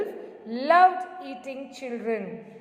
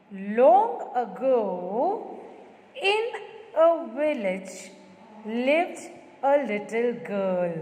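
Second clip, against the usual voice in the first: drawn out; much slower than usual. Shown as a demonstration. The teacher reads aloud to the children, slow and stretched. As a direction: stretch Long ago out slowly. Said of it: "I'm saying long ago, that means I'm saying".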